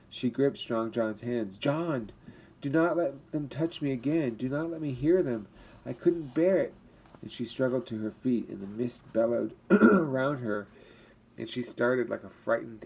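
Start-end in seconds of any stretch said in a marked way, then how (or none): 1.6-6.7 terrified begging. Acted. Terrified woman begging John.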